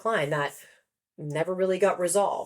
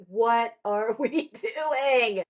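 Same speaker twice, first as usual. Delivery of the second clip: drawn-out, higher mock cry with laughter; mock scream; 5 semitones higher, much slower than usual. Author screaming into a hurricane, drawn-out mock yell. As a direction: stretch it out like a half-laughing scream. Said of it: "I'm screaming into a hurricane and going".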